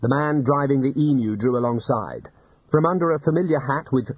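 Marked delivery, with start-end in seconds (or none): none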